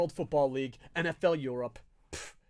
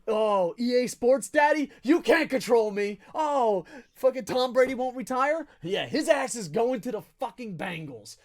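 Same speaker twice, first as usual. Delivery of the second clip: loud, raised-pitch tirade at EA; angry rant; 5 semitones higher, 5 dB louder than usual. Real, not acted. Gamer shouting at EA over his team. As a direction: yell your fury at the game maker.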